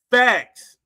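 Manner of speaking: loud, clipped declaration; emphatic punchline. Retold or imitated. Hammering each boast with a loud mock "Facts". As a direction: slam the word down. Punctuating each boxing claim.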